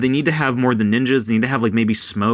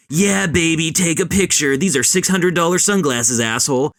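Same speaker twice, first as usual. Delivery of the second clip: cocky, higher show-off voice; haughty Johnny Cage; 6 semitones higher than usual. Retold or imitated. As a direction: strut it like a smug movie star. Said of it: "meant to be this very haughty thing".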